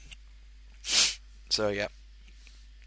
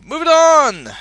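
hearty shout; 14 semitones higher, 10 dB louder than usual. Acted. Announcing the next segment.

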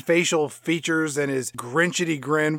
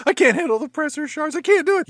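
mock panicky cop; 9 semitones higher than usual.

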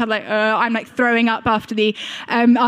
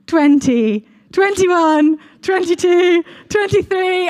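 counting on, louder and higher as the panic builds; child still counting under the mask, getting higher and alarmed; rising alarm; 8 semitones higher, 6 dB louder, much slower than usual. Retold or imitated. Counting under gas mask, not going under.

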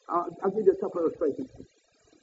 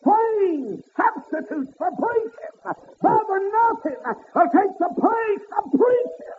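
slow high whooped repeat; 12 semitones higher, 4 dB louder, much slower than usual. Acted. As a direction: stretch "Slain" high and long.

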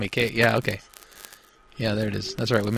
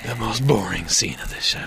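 whispering. Real, not acted. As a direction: breathe it out under the show. Whispered aside.

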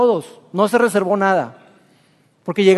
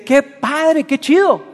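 delighted king; 4 semitones higher, much slower than usual. Acted. King celebrates the army leaving.

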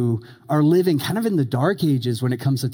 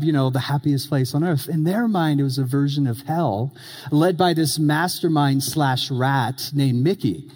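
ordinary talk

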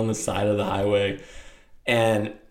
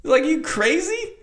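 high, loud, amused Texan rancher voice; mocking rancher; 3 dB louder than usual. Rancher laughs at the stranded father's plan, high and loud. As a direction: scoff at the crazy idea up high. Starts laughing his head off at my dad.

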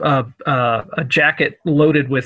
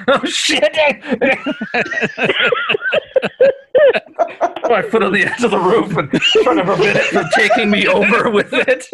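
laughing panic; 9 semitones higher than usual. Acted. Ladder falls, laughing panic.

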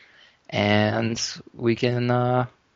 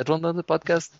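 "What is this?" in a clumsy mock Dutch accent, pitched higher; bad Dutch accent; 6 semitones higher than usual. Host imitating the winner hearing the podcast, in a bad Dutch accent. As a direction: play a confused Dutch winner in a hammed-up Dutch accent. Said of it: "I don't know how to make a Dutch accent".

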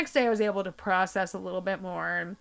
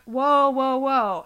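louder, halting interruption; whoa, slow down; 4 semitones higher, 7 dB louder, much slower than usual. Retold or imitated. Daughter cuts off her panicking mom, louder. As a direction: stop her mid-flow. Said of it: "I'm like".

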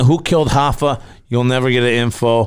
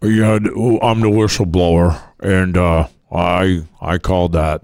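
lowered anonymous-witness voice; disguised deep voice; 7 semitones lower than usual. Pretend. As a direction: speak like a hidden whistleblower on TV. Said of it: "We look like these guys".

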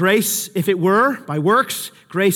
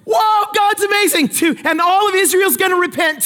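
much higher, thrilled exclamation; excited prophet; 12 semitones higher than usual. Retold or imitated. Elijah imagining national repentance, high and thrilled. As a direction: gush like the victory has come.

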